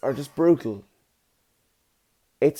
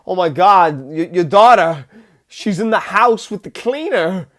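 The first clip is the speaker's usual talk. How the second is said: alarmed psychic, loud and higher; Christopher Walken; 5 semitones higher, 6 dB louder than usual. Retold or imitated. Retelling Walken's psychic sketch to viewers, mock alarm. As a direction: blurt out an urgent vision. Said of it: "He's like".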